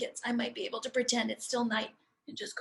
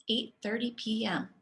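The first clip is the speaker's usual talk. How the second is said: ordinary delivery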